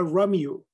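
"Romeo" as English ROH-mee-oh; anglicized pronunciation. Shown as a demonstration. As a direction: say "Romeo" with American r and vowels. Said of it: "no Romeo".

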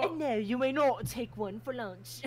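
"not" crisp and clipped-British, not Southern; British slip. Acted. Tally's Southern line going British. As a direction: say "And no, you may not" with a British "not". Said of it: "You're making British".

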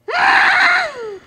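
high shouted groan of frustration; loud exasperated groan. Real, not acted. Reacting to the game.

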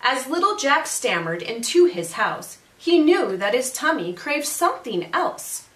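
lively, singsong storytelling; picture-book read-aloud. A narrator's reading. Teacher reads a zombie picture book to families, animated read-aloud. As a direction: read it to children with rhythmic emphasis.

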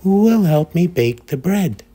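high, eager little hen. A narrator's reading. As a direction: ask brightly like a small hen. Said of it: "the little red hen asked".

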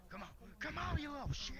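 high, loud rising yell; frustrated shout. Real, not acted. Gamer yells high and loud at the enemy to die. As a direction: scream at the creature to die.

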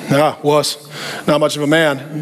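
a hint of a gruff dad scoffing. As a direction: sneer it like a tough old dad. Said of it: "your dad would have ... been like".

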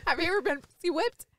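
imitating partner's delivery. Woman repeats her partner's question back, mimicking. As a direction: throw the question back, mimicking how it was said. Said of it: "trying to say it how you said it".

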